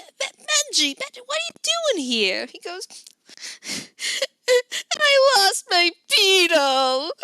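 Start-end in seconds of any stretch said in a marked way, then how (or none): 0.0-2.5 high, fast stammering auntie; flustered auntie. Acted. Auntie voice again. 5.0-7.1 put-on high, loud, slow sobbing child; tearful child. Crying child voice.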